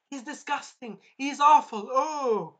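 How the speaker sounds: hammed-up scandalized onlookers; mock disgust. Voicing the crowd's disgust.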